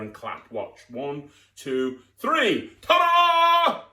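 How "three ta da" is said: much higher, loud ta-da; triumphant reveal. Acted. Magician revealing the egg to the crowd, loud and high. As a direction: land the big finish.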